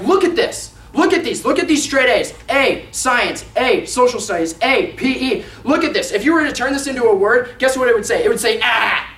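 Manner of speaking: imitating a thrilled kid, high and loud; excited bragging. Boy shows Dad straight A's, acted loud excitement. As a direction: rattle off each triumph in a high, punchy shout. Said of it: "He's so excited about what he has done".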